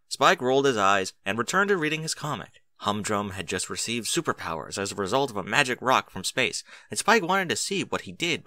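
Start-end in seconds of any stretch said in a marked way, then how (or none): none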